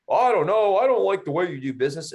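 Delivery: huffy, offended buyer impression; mocking the buyer.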